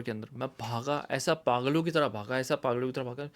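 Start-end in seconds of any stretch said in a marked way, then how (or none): none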